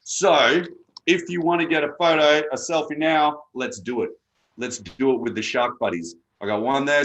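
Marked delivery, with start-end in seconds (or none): none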